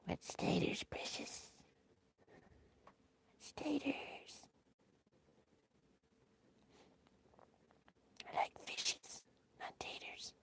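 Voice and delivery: hissed, whispered creature voice; breathy whispered Gollum hiss. Acted. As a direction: whisper it like a sly cave creature. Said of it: "More like".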